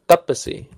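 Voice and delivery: "Tapasi" stressed on TA, short pa; stress on first syllable. Shown as a demonstration. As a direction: hit the TA in "Tapasi", keep pa light. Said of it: "This is where the stress goes".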